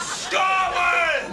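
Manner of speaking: high-pitched battle cry; impassioned yelled chant; defiant shout. Acted. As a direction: bellow it like a patriot.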